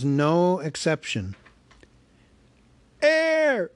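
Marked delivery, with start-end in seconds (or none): 3.0-3.7 high, slow squeaky shout; a tiny purse squealing in alarm; talking purse squeaks out in fright; squeaking purse. Acted. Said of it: "it squeaked".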